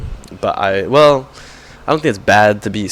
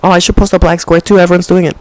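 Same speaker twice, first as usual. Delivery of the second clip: high put-on airhead voice; mock trend follower; 8 semitones higher than usual. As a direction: sneer at bandwagon posters. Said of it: "It's just".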